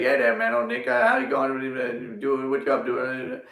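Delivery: a loose imitation of Boomhauer's rambling greeting; Boomhauer impression. Greeting great uncle Floyd, imitating Boomhauer. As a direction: run the words together like Boomhauer, half-hearted. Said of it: "he talks like Boomhauer so badly".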